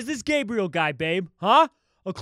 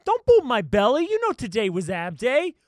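ordinary delivery

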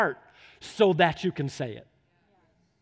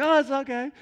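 mom's reassurance; 6 semitones higher than usual. Retold or imitated. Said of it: "She's like".

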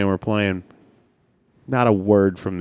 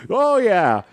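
put-on high, bright-eyed English bloke cheering, English accent all through; mock English teammate celebrating the win, in an English accent; English accent impression. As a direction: ham up a chirpy English accent, well up in pitch. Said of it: "you just hear this English voice like".

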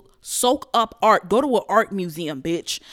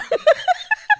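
high cackle; 3 dB louder than usual. Real, not acted. Laughing at own joke.

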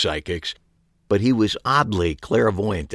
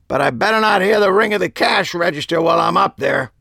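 put-on higher, firmer old-man warning; emphatic; 10 semitones higher, 3 dB louder than usual. A narrator's reading. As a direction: lay down the law, word by word. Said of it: "stated emphatically".

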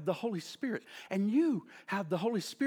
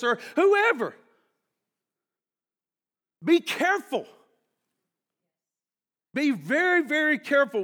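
raised, emphatic pulpit voice; higher, punchy sermon delivery; preaching warning; 5 semitones higher, 8 dB louder than usual. Real, not acted. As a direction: warn the congregation forcefully.

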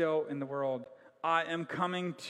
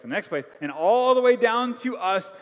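rising preaching climax; 6 semitones higher, 9 dB louder than usual. Real, not acted. Climax of sermon.

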